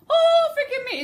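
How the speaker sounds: high, loud outburst of begging; pleading character. Shown as a demonstration. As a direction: demonstrate a big theatrical plea. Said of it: "you can do the whole".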